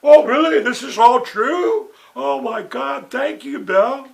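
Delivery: hammed-up gullible squeal of thanks; a woman gushing her thanks to Bill; gushing surprise. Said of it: "And she goes".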